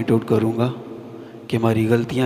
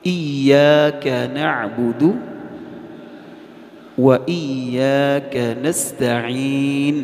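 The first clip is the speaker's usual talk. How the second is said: slow chanted, each letter full; correct slow recitation; much slower than usual. Shown as a demonstration. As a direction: recite it slowly and clearly. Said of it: "کیا بولنا ہے؟". (آہستہ اور درست تلاوت)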